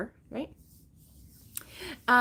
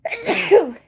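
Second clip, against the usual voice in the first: sneezing; 8 semitones higher, 11 dB louder than usual. Real, not acted. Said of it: "I gotta sneeze".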